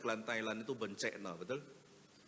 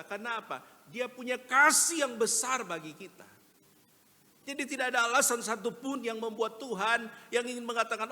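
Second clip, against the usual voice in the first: much higher and much louder, near shouting; impassioned preaching; 11 semitones higher, 10 dB louder than usual. Acted. Preacher tells the church God loves sinners, shouting passionately. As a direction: preach it with everything you have. Preacher climax, full force.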